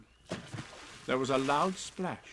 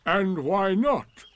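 loud, slow, raised and indignant; affronted donkey, drawing it out; cold; 6 semitones higher, 8 dB louder, much slower than usual. A narrator's reading. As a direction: challenge them coldly. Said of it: "said Eeyore coldly".

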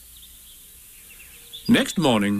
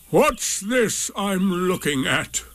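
slow, higher-pitched donkey voice; ponderous donkey; 6 semitones higher, much slower than usual. A narrator's reading. Donkey testing Rabbit on the sticks, ponderous. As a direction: draw the question out ponderously.

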